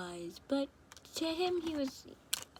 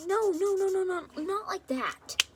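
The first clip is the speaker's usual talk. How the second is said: louder, higher, hurried no-no-no; slowing down on not like that; flustered denial; 9 semitones higher, 5 dB louder than usual. Acted. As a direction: wave the idea off in a panic.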